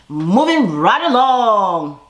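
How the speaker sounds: slow sing-song segue. Shown as a demonstration. Vlogger moving on to the next scene, slow and loud. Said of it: "drawn-out segue catchphrase".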